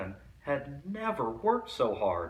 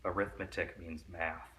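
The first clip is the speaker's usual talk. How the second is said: plain reading voice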